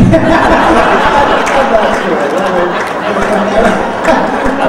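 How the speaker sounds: loud high burst of laughter; big laugh. Real, not acted. Speaker bursts out laughing at the boy's punchline. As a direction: cracks up hard.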